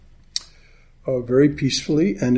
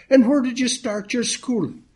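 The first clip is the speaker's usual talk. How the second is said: put-on old Irishman; 11 semitones higher than usual. Old gent quizzing his drinking partner, in a high Irish old-man voice. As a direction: pipe up like a chatty old codger.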